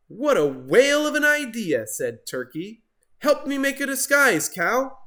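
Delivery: high, loud eager voice; excited Turkey. Acted. Turkey asking Cow for help, high and eager. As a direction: bubble over with a new plan.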